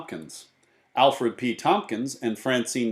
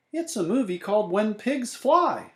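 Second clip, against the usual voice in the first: Mrs. Watson's voice; 11 semitones higher than usual. A narrator's reading. Mrs. Watson answers in story.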